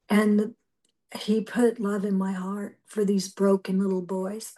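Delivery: normal talking voice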